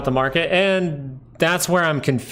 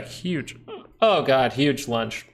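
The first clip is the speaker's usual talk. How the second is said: hiccups; much slower than usual. Real, not acted. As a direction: still hiccuping after lunch. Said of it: "I'm hiccuping".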